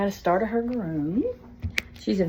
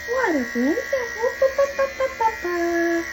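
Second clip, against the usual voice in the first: very high squeaky cooing; squeaky dog talk. Real, not acted. Groomer cooing a squeaky chant at the dog. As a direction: chirp whoop whoop up high.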